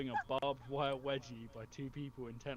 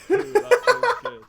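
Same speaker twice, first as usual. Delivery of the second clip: laughing; 19 dB louder than usual. Retold or imitated. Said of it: "while muttering".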